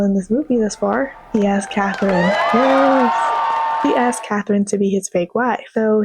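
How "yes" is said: shouted yes. Real, not acted. Vlogger shouting yes about the fake wife. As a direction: shout the confirmation.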